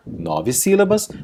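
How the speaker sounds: usual speaking voice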